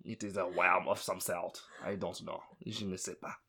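put-on a shrugging French accent on je ne sais pas; understated French accent; mock French accent. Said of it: "I love the worm in the French accent".

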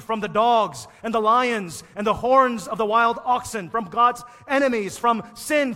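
loud, high triumphant proclamation; preaching at full force. Acted. Preacher proclaiming rescue loudly to the church. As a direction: hammer each from like a victory cry.

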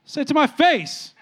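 high, loud mock challenge; confrontational dad. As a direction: call out the muttering kid, indignant and high. Dad challenges grumbling kid.

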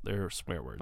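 hushed unvoiced aside; whisper. Whispers to his co-host that they're swear words. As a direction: whisper it like a secret. Jokingly flagging words to censor.

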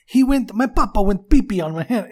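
comic Russian-English impression breaking into laughter; broken Russian English. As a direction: imitate broken Russian English while cracking up. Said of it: "why did you do the broken Russian English".

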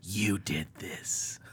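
slow accusing stage whisper. Acted. Co-host playing a son blaming his mom, slow whisper. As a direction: lean in and whisper it, drawn out. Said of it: "Then you lean in and whisper".

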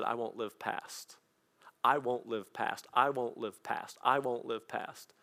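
slow, soft chant of the vow; slow repeated refrain. Retold or imitated. Quoting grandpa's vow, slow and soft. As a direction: let each repeat land slowly.

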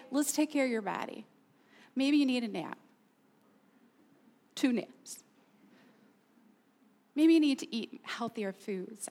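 soft, gentle caretaking voice; loving kindness. Acted. Preacher voicing God's answer to an honest person, kindly. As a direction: offer it softly, as a kind suggestion. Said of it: "God's response to our honesty is loving kindness".

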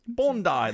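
Bondi said high as BON-dye; Aussie pronunciation. Shown as a demonstration. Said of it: "That also sounds Aussie".

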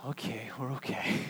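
soft unvoiced whisper of relief. Pastor voicing the congregation's relief, whispered. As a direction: breathe it out like a sigh of relief.

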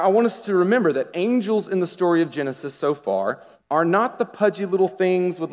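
ordinary delivery

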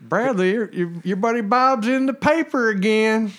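high, loud, drawn-out dad voice; hammed-up impression of a dad; Marty impression. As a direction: call it out high and slow like a friend's dad. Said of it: "I do a good Marty impression".

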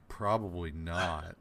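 low, slow, deadpan mock gloom; the inner pessimist shutting it down; pessimist reply. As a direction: drop the pitch and drag it out flatly. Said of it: "And it's like".

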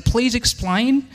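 a bad politician impression, drawn out slowly; lightly hammed-up impersonation, stretched out; Pauline Hanson. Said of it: "That's a bad Pauline Hanson impression".

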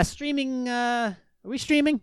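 usual speaking voice

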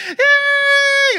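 high, loud squealing cheer; excited kids cheering. Retold or imitated. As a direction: shriek with glee like little kids. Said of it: "and they're like".

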